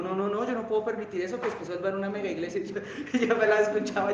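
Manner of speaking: normal talking voice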